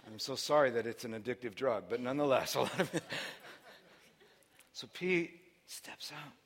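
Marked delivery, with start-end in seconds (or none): 4.7-6.3 slow whispered storytelling beat; hushed whisper. Acted. Pastor whispering to the congregation as Peter steps out.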